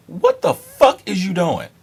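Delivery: high, loud disbelief; exasperated, high-pitched and punched; incredulous outburst. Retold or imitated. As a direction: shout it as if appalled at a friend. Retold outburst at friend's approach.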